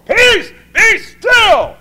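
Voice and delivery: high, loud, slow peace be still; commanding shout. Acted. Jesus commanding the storm on the sea, slow shout. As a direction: rebuke the storm at full voice.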